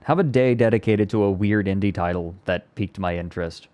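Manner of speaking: ordinary talk, nothing marked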